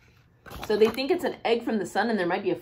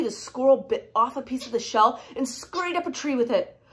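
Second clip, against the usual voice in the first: ordinary delivery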